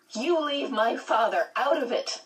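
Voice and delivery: put-on a girl flaring up, restrained shout; mild shout. A narrator's reading. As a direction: read as a contained, high-pitched shout. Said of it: "Meg shouted".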